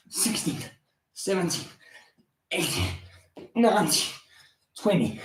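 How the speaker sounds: soft, strained rep count; counting through exertion. Real, not acted. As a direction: count quietly between push-ups. Counting while doing push-ups.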